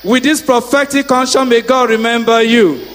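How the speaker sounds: loud, chanting blessing; fervent prayer. Acted. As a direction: pray it over them with force. Praying blessings at full force.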